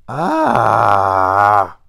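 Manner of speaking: sudden loud exasperated "Ah!"; loud drunken outburst of frustration; loud gruff shout. Acted. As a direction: shake it off with a loud cry. Tam Lin shakes off his rage.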